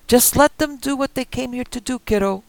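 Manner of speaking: high, loud, rough threat; old man with shotgun warning the kid, loud; armed old man threatening. Acted. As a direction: bark it through the door. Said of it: "Marty said through the window".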